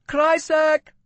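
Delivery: high, loud market cry; calling out wares. A narrator's reading. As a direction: hawk your gems to the crowd. Called a woman holding up.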